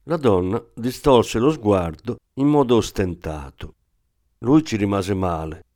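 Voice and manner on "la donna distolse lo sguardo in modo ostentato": ordinary delivery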